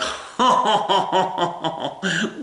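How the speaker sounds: high French "oh ho ho ho"; mock French laugh. The French reacting to women soldiers, mock French laugh. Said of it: "awful French accent".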